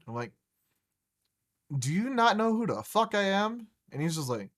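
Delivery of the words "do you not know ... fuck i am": mock indignant. Retold indignant question to vendor.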